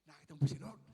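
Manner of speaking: loud, eager burst of a question; can't wait to know; excited question. Retold or imitated. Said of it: "excited ako eh". (sabik at malakas na tanong)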